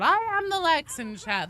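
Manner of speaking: loud declaration. Acted. Declaring in chat.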